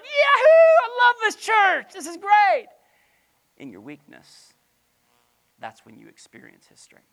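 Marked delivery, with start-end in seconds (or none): 0.0-2.7 high, loud whooping cheer; mock cheering. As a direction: cheer like an overexcited congregation. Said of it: "We'd all be like".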